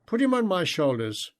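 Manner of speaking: ordinary delivery, nothing marked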